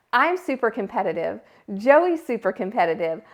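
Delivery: ordinary talk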